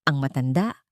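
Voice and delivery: plain reading voice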